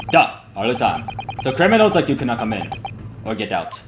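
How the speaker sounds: lightly voiced Russian guard, smug; a hint of a Russian sailor's accent, gloating. Guard taunting the stowaways, light Russian accent. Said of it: "me voicing him is also a little bit stereotypical".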